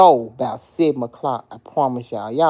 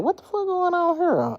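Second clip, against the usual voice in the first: mock bafflement; 14 semitones higher than usual. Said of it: "I'm like".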